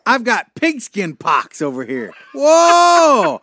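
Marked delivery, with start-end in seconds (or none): 2.3-3.4 loud high yelp of mock sickness; hammed-up cry of pain; mock fever yelp. Fake football fever fit.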